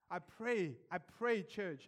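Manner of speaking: usual speaking voice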